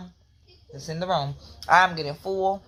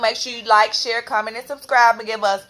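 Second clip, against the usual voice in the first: loud, high upbeat sign-off; cheerful outro; 6 semitones higher, 5 dB louder than usual. Real, not acted. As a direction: pump up the viewers. Outro call to subscribe.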